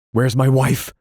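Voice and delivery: loud, high frantic demand; crying wildly. Acted. Husband bursting into the house, wild loud cry. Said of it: "he cried wildly".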